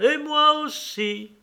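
very high, drawn-out exclamation; high-pitched acted chorus cry; children chiming in. A narrator's reading. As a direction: echo it eagerly for all the children. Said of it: "Tous répétèrent".

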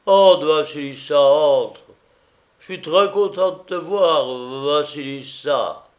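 boozy, eager greeting; drunken master, welcoming. A narrator's reading. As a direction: usher her in effusively.

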